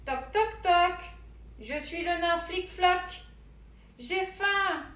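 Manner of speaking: a small hungry dwarf at the door; dwarf knocking. A narrator's reading.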